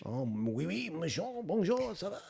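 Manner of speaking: playful stock-phrase French; French accent. Shown as a demonstration. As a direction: put on a French accent for show. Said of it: "go and do your French".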